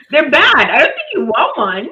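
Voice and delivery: loud, high-pitched mock protest; loud, animated retelling; incredulous exclamation. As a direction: exclaim it like a comic warning. Acted reply about the ribs.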